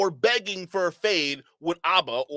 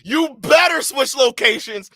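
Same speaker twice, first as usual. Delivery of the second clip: louder, higher and slow, yelling at the screen; loud taunting yell; shouting; 6 semitones higher, 8 dB louder, slower than usual. Real, not acted. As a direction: shout the warning. Shouting at clip.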